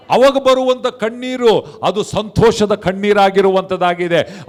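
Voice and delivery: loud, driving sermon delivery; impassioned preaching. Real, not acted. Preacher tells congregation of risen Jesus, impassioned and loud. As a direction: preach the good news with rising force.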